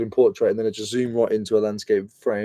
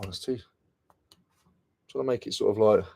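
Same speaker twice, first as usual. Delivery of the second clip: normal talking voice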